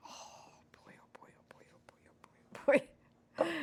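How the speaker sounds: under-the-breath whisper. Real, not acted. Struggling with the dough, whispered to herself. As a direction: mutter it to yourself. Muttering while struggling with mixing.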